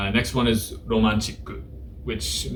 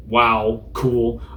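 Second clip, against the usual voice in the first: normal talking voice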